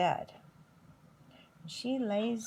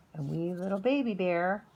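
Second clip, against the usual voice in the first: plain reading voice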